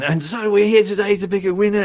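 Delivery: high-pitched mock host recap; show host recapping. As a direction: imitate a presenter restarting the build-up. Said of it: "run all through the whole thing again".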